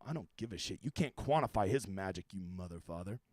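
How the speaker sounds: low mock growl. Ranting at imagined model doubters.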